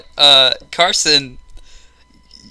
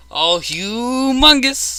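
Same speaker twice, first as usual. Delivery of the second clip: long, rising, drawn-out exclamation; slow, swooping recall of a catchphrase; drawn-out recognition; 10 semitones higher, much slower than usual. Retold or imitated. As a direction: stretch the word out high in mock awe. Recalls and mimics the meme.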